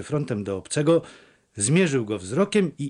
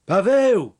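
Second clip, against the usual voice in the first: loud, higher call of a name, falling at the end; raised-voice summons; 7 semitones higher, 6 dB louder than usual. A narrator's reading. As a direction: call after someone walking off. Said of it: "zawołała go".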